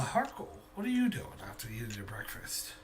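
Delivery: usual speaking voice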